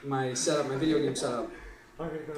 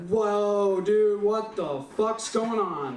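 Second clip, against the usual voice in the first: slow stoner awe, pitched up; tripping amazement; 4 semitones higher, much slower than usual. Acted. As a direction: stretch it out, mind blown by the trip. Reacting to the acid kicking in.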